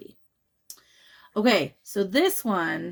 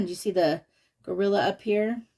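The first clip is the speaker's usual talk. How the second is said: usual speaking voice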